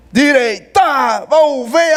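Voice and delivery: slow drill order; drill command. Retold or imitated. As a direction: call it like a drill sergeant. Captain drilling him again. (voz de comando de quartel)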